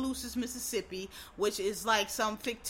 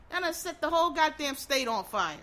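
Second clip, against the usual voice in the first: ordinary talk, nothing marked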